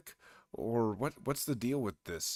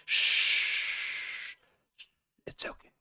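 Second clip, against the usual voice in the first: imitating a shush; much slower than usual. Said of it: "just there shushing him like".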